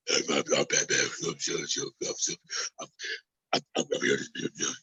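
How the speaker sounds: low strained whisper, mocking a hoarse Batman. Mocking Bale's Batman for his co-host, whispered strained growl. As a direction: push the words out on breath like a caped vigilante. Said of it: "That's how he talks throughout the whole movie as Batman".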